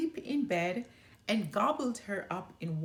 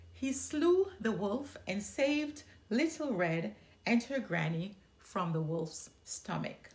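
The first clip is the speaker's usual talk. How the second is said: usual speaking voice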